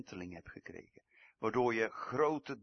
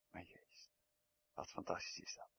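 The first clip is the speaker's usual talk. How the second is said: awed whisper; preacher hushed by awe, whispering; 4 semitones lower, 13 dB quieter than usual. Real, not acted. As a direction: breathe it out in wonder. Awed hush after the prayer.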